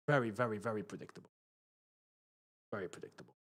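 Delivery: low, soft and deflated; quiet, sinking disappointment; resigned muttering. Real, not acted. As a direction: trail down to a resigned murmur. Resigned after another predictable mistake.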